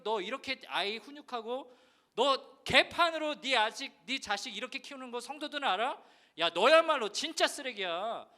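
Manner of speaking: rebuking inner voice. Retold or imitated. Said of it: "라는 마음을 갑자기 하나님 주시는데". (목사를 꾸짖는 하나님의 음성, 점점 커지는 호통)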